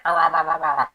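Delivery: loud playful growl back at the dog; mock growl rah. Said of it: "I'm like".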